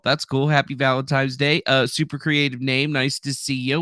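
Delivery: usual speaking voice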